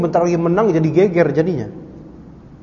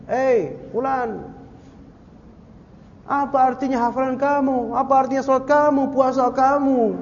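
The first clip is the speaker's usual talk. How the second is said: imitated sorrowful calling; 8 semitones higher than usual. Grieving Muslims questioning their lost comrade, sorrowful calling. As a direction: plead from afar, stretching the words. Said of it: "Para muslimin saking sedihnya tuh".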